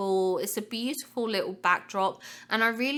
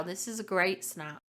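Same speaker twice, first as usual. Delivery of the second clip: ordinary delivery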